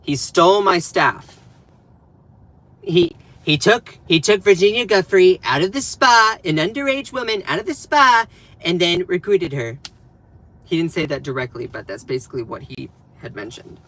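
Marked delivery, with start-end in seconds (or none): 0.0-9.8 louder, higher, indignant retelling; mock Trump quote. Streamer quoting Trump's Epstein story, with loud mock indignation. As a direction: ham up an aggrieved boast. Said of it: "then it turned into".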